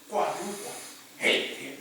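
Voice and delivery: ordinary talk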